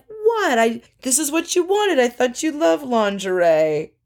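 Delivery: high-pitched mock disbelief; hammed-up bewildered protest; baffled protest. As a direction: imitate someone taken aback, pitched well up. Acts out Bobby's baffled protest at dinner.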